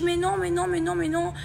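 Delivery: high, repetitive protesting; imitated denial. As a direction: mimic a friend denying it over and over. Copine qui nie, imitée. (dénégation aiguë imitée)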